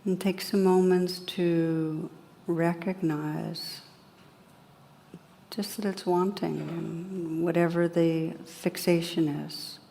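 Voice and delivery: calm, unhurried guidance with long pauses; guided meditation. Real, not acted. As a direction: gently lead listeners inward, leaving space after each phrase.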